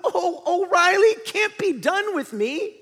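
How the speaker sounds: high, loud panicked protest; Bing Bong. Acted. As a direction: wail it out like a distraught cartoon character. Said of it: "And Bing Bong says".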